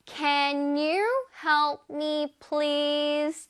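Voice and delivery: Can you help me, please? 를 다시 한 번 아주 천천히; slow model sentence. Shown as a demonstration. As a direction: repeat slowly for learners to copy. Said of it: "One more time". (다시 한 번 천천히 또박또박)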